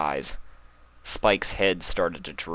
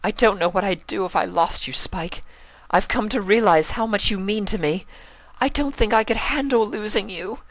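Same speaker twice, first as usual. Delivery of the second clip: tearful Rarity; 3 dB louder than usual. Acted. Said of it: "started to cry".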